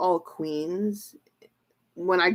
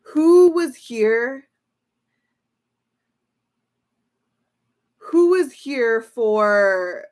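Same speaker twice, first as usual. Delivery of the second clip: slow, louder, stretched question; drawn-out question; 3 semitones higher, 6 dB louder, much slower than usual. Real, not acted. Asking her viewers about the earlier reading, slow and loud. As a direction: stretch every word out to the viewers.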